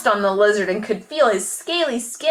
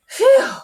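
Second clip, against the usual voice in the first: relieved phew; 8 dB louder than usual. A narrator's reading. Relief after escaping bees.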